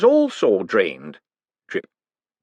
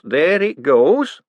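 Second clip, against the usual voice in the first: higher, louder, slow and thrilled; excited drawn-out repeat; 6 semitones higher, 4 dB louder, much slower than usual. Acted. Hubert thrilled as his machine drains. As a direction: stretch it out, delighted.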